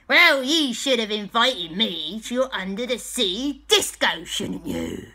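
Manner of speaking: loud, huffy pirate; Captain Blackpaw. Acted. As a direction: complain like a pirate left out of the fun. Pirate complains of being left out.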